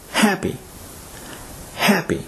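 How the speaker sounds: happy, first syllable high, then falling; stress on first syllable. Shown as a demonstration. Teacher models happy for learners, stressing the first syllable. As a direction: hit the "hap" in happy. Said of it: "happy is stressed on the first syllable".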